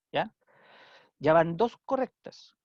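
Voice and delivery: ordinary talk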